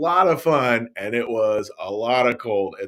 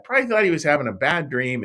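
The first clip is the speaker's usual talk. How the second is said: normal talking voice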